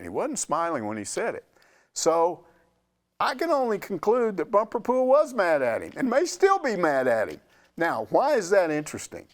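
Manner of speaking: ordinary talk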